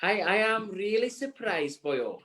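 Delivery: a slow, hammed-up Welsh lilt, badly done; deliberately awful Welsh accent with a drawn-out "really"; terrible Welsh accent. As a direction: play at being Welsh on purpose and badly. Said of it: "That is a terrible Welsh accent".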